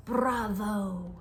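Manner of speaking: plain reading voice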